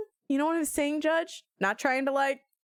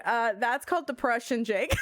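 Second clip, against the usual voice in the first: ordinary delivery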